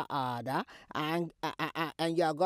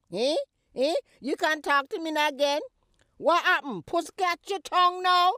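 high, loud mocking taunt; 8 semitones higher, 8 dB louder than usual. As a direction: goad her to answer back. Mother taunting silent daughter.

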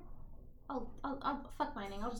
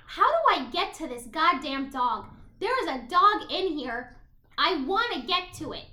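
frustrated outburst; 3 semitones higher, 6 dB louder than usual. Real, not acted. Player who can't reach the dog, exasperated. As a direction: whine loudly in frustration.

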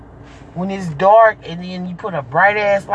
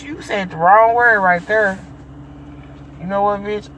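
ordinary delivery

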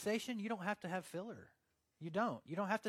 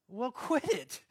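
loud, high retort with a laugh; exasperated retort; 7 semitones higher, 7 dB louder than usual. Retold or imitated. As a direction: laughingly tell a friend off. Retorts to friend's excuse, laughing.

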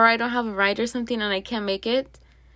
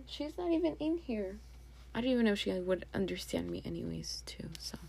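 hushed whisper, a little fast; 2 semitones lower, 10 dB quieter than usual. Real, not acted. Owner whispering so the cat won't hear. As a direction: keep it under your breath. Cat not in room, secretive aside.

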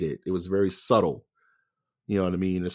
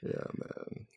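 ordinary talk, nothing marked

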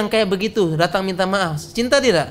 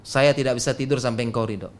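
fast, lower and run together; quick repeat; 6 semitones lower, 4 dB quieter, faster than usual. Retold or imitated. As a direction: rattle it through in a lower voice. Same line repeated quickly and lower.

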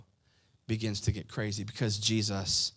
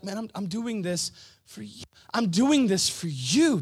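impassioned plea; 8 semitones higher, 6 dB louder than usual. Acted. Jesus pleads with those killing him, loud impassioned cry.

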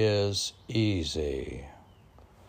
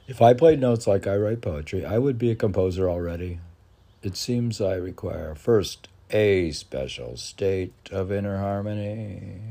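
measured recital of his own verse; poem reading; 7 dB louder than usual. Acted. Poet reading his poem aloud to the podcast host, recital voice.

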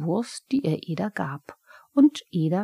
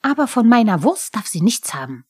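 louder, fast kobold yell; shouting after him; 4 semitones higher, 10 dB louder, faster than usual. A narrator's reading. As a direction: call after him, protecting his sausage. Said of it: "schrie ihm der Pumuckl nach".

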